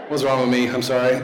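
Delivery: ordinary delivery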